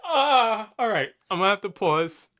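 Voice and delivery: ordinary delivery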